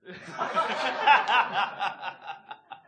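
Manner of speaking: loud falling laughter. Real, not acted. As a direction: burst out laughing. Laughs at backstory joke.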